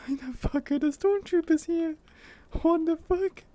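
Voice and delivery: squeaky posh lady character; high posh lady voice. Acted. Voice actor testing character voice.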